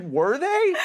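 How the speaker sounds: high, loud mock disbelief; incredulous. Patient doubts the guide's rosy verdict, high loud incredulity. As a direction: question it sharply with a big jump in pitch.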